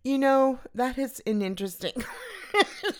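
real laugh breaking the words. Real, not acted. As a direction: fight through the giggles. Laughing fit continues.

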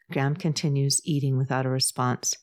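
plain reading voice

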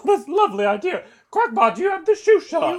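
high posh lady voice. Acted. High put-on voice continues.

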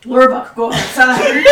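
loud, booming broken-English brute; dumb orc voice. Acted. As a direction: boom it out like a hurt dumb orc. Orc character yields.

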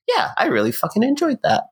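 high, quick upbeat burst; happy retold reaction. Movie-goer recalling walking out happy, excited. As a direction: retell a delighted reaction, pitched well up. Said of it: "I was really happy".

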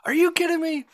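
very high, incredulous squeak; excited disbelief. Retold or imitated. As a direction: imitate someone thrilled and stunned. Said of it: "he was so excited".

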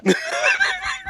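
real laugh breaking into a shriek. Real, not acted. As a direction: let out a screaming cackle. Loud burst of laughter.

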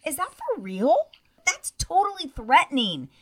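high, indignant protest; outraged disbelief. Real, not acted. Continues outraged rant.

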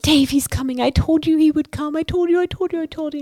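grabbing her boss, high excited chant; excited squeal. Retold or imitated. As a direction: bounce with excitement. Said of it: "I'm like".

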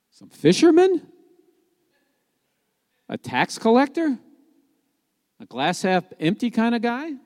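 incredulous questions, voice climbing high at the end; mock disbelief. Preacher questioning who was chosen as disciples, mock-incredulous. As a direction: ask each as if it's an absurd choice.

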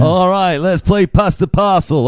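high, bright compere calling out games; mock party host. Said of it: "And I'm the compere".

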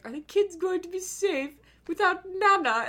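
high, worried mother voice. Retold or imitated. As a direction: fret like an anxious mom. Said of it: "she was like".

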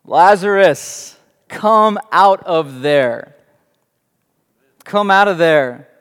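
loud, slow, commanding call into the tomb. Acted. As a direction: call the dead man out with authority. Jesus calling Lazarus from tomb.

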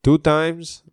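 a hint of Mexican accent on "Two times". Host dubbing the guest in a Mexican accent. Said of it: "I'm doing a Mexican accent".

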